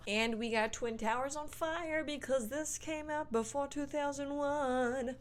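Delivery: high, awkward singsong, slowing at the end; high strained voice. Pretend. Host awkwardly notes the movie's dated imagery, high strained voice. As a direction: push it up high and stretch the year.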